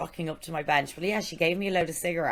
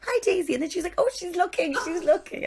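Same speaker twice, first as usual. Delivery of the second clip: very high baby-talk imitation; mock thrilled-mum voice; excited mother to baby; 4 dB louder, faster than usual. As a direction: squeal it high for the baby's benefit.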